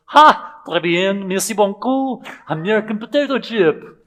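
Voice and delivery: high, loud, hammed-up French delight; imitated French guest thrilled beyond measure; overjoyed Frenchman. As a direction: burst out in an ecstatic mock French accent. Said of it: "he was so excited".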